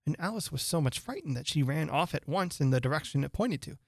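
ordinary delivery

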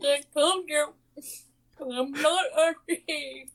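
high, drawn-out mock complaint; hammed-up sticky-mouthed whine; talking through caramel. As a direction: pretend to answer through a mouthful of caramel, slowly. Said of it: "all the answers will be all, like, through caramel".

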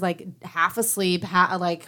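ordinary delivery

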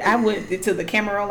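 ordinary delivery

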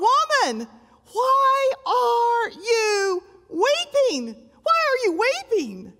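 high, slow ringing call; a preacher voicing the angels' high cry; angels calling out. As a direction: lift it like heaven calling down. Angels calling out to weeping Mary.

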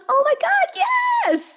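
very high, loud squeal of joy; excited little kid. Acted. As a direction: cheer like a thrilled child. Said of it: "like a little kid going".

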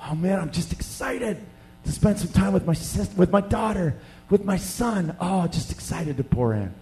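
softly voiced, gently excited father. Father looking forward to time with his children, softly excited. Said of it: "he's excited".

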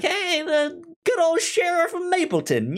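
usual speaking voice

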